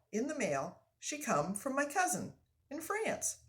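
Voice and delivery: put-on lively French-Canadian accent; animated old Frenchman, thrilled; hearty French-Canadian voice; excited French-Canadian lumberjack. Frenchman's voice reading a story.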